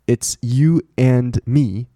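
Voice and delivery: drawn-out "It's you and me"; slow model phrase. Shown as a demonstration. Teacher models the example for learners, slow phrase. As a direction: say each word slowly.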